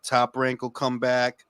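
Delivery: usual speaking voice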